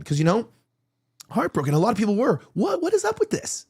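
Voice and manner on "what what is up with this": high, rising incredulous question. Acted. Host mocking a suspicious widow, high incredulous question. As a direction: ask it in mock disbelief.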